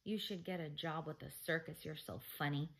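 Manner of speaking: usual speaking voice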